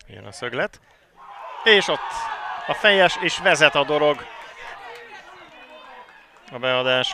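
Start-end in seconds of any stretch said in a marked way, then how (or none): 1.6-4.3 high, loud goal call; excited Hungarian sports commentary. Real, not acted. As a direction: cry out the goal as it happens. Goal called live.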